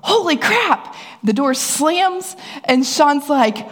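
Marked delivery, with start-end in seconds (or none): 0.0-0.9 high startled yelp; retold shout. Husband startled by attic snake, high yelp. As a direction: mimic a husband's startled cry. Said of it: "then I hear shouted".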